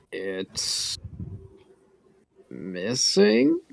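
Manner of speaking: very quiet, halting, rising question; sheepish. Acted. Caught out over the lost earpiece, sheepish. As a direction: trail up into an uncertain question.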